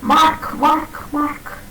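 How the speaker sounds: "mark" with the r sounded, repeated in a rising tone; mock the learner who says "mark" with an r; student's r-ful mark; imitating a wrong mark. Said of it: "You always talk about".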